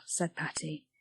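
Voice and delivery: ordinary delivery, nothing marked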